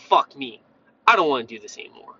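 low, mock-weary grumble; fed up on waking. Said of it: "you're gonna be like".

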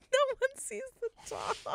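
high laughing repeat. Real, not acted. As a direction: laugh through the words. Repeating friend's line, laughing.

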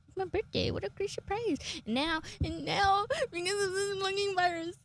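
high, choked-up complaint; mock tearful. Lamenting the cancelled birthday show, high and mock tearful. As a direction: whine it high as if close to tears. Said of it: "why am I tearing up?".

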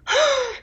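a high yelp of a gasp; the dog's sudden high gasp; dog's high startled gasp. Retold or imitated. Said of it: "he goes".

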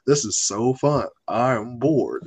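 low, unenthused imitation; C J's monotone. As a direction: play at having fun with no feeling. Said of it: "he just monotone through life".

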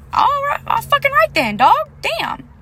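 sarcastic retort. Retold or imitated. Retelling her reply to the tattoo shop owner, high sarcastic squeal.

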